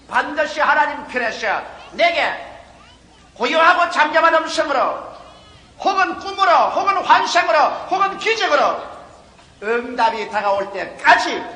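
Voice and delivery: rising sermon cadence on a list; forceful preaching. Real, not acted. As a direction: preach it with mounting insistence.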